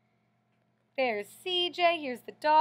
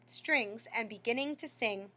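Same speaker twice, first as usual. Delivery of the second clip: ordinary delivery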